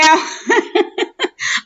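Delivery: a high laugh at her own joke; bright high laugh. Real, not acted.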